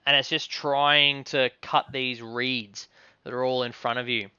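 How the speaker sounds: ordinary talk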